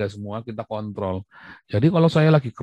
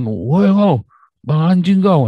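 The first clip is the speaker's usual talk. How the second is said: raised-pitch mock calling-out and cursing; mock direct insult; 6 semitones higher, 3 dB louder, much slower than usual. Speaker shows what he must not say to someone, mock insult. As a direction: pretend to swear right at the listener. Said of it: "saya lagi ngomong sama kamu nih".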